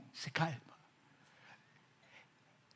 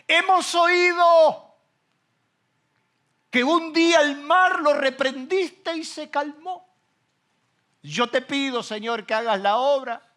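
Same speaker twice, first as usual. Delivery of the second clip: loud, slow preaching prayer, much higher; fervent prayer; 11 semitones higher, 10 dB louder, slower than usual. Acted. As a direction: cry out to God with full force. Prays aloud, preaching force. (oración predicada a toda voz)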